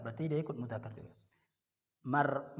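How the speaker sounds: normal talking voice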